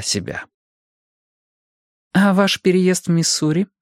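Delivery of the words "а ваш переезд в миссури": higher-pitched female questioner; Bonnie. A narrator's reading. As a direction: ask in a lifted woman's voice. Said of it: "спросила Бонни". (высокий женский голос, с вопросом)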